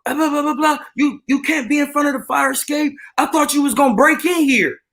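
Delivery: high-pitched mock rant of a woman flipping out; yapping Karen. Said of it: "she's steady yapping".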